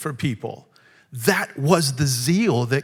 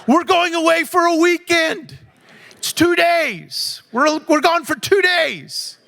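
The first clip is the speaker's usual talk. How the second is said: much higher, louder laughing tirade; mock exasperated shout; 12 semitones higher, 5 dB louder than usual. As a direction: squeal it out, half laughing at the pile of luggage. Mock scolding over packed car.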